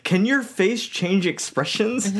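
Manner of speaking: deliberate, high quoted question; voiced princess. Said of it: "Or she's like".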